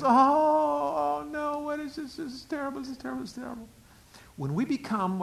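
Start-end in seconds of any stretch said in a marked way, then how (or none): 0.0-3.7 high, softer panicked fretting; a frightened soul wringing its hands; panicky soul. Acted. Soul panics at the enemy's lies, fretting softly. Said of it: "our soul gets a hold of that and goes".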